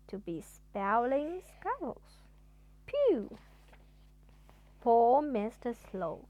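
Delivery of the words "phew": phew. Acted. Exclaiming relief.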